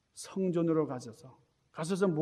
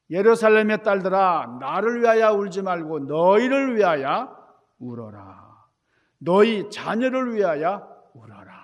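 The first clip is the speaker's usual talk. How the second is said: loud, solemn proclamation; voice of Jesus; 8 dB louder than usual. Retold or imitated. Jesus on the road to the cross, loudly addressing the daughters of Jerusalem. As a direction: declare it loudly to the weeping women. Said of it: "이렇게 말씀하셨어요".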